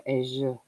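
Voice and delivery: Asia as a fancy AY-zhuh; fancy pronunciation. Shown as a demonstration. Streamer explaining why they say it that way, fancy. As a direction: give Asia a soft, pretentious zh. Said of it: "it sounds fancy when I go".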